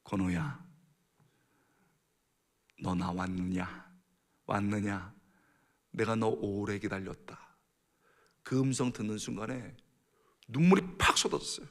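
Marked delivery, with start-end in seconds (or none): 0.0-7.5 low, soft, slow divine voice; hushed, deliberate God speaking to his child. Retold or imitated. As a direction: speak gently inside his heart, unhurried and low. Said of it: "하나님의 음성을 들었어요".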